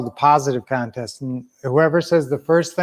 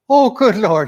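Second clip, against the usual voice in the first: higher, louder mock astonishment; Irish priest exclaiming; 7 semitones higher, 5 dB louder than usual. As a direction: cry out like a priest witnessing a miracle. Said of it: "the priest looks down at the bottle and says".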